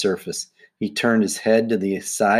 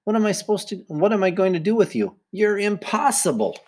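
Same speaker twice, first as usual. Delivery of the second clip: high-pitched, despairing coach; exasperated; 10 semitones higher than usual. Acted. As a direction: throw up your hands at a hopeless pupil. Coach despairs of giggling pupil.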